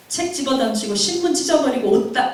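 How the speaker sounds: ordinary delivery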